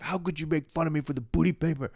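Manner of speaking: high-pitched mock indignation; re-enacting an aggrieved protest; pissed, hurt. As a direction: wail the complaint, hammed up.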